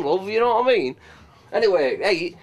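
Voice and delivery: ordinary talk, nothing marked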